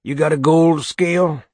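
whiskey voice. A narrator's reading. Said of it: "the whiskey voice said".

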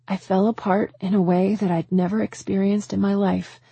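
ordinary delivery, nothing marked

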